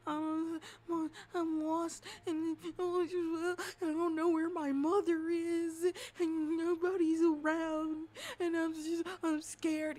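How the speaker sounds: put-on high, loud childlike wail; whimpering little girl. Lost little girl tells a stranger she's scared, whimpering. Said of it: "crying hysterically, but she's like".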